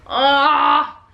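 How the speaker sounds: real achy, drawn-out groan; long "ah" of discomfort. Real, not acted. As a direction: groan from an uncomfortable body. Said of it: "so uncomfortable".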